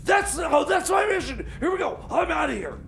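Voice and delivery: normal talking voice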